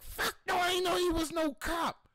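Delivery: high incredulous protest; shocked denial. Retold or imitated. Answering Shantae's question, high shocked denial. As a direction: insist you had no idea.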